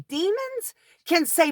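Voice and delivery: ordinary delivery, nothing marked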